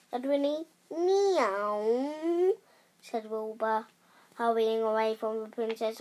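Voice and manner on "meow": drawn-out meow, swooping in pitch; acted cat meow. A narrator's reading. Wilbur the cat answers Winnie, meowing. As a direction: miaow like a cat in a story.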